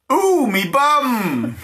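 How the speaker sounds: slow, high ooh me bum; Grim, bottom burning, a hammed-up whine; comic moan. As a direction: groan it in mock pain.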